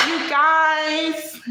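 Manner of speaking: high, stretched-out put-on voice; high caricature, drawing out the words; Vegas. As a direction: mimic a friend's high-pitched call to the group. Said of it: "I can't do her".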